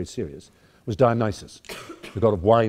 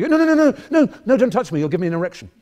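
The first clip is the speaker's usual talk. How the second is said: much higher, louder squeal of mock panic; mock prissy shriek; 12 semitones higher, 7 dB louder, faster than usual. Mock victim squealing at the players. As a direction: squeal like a scandalised victim fending off hands.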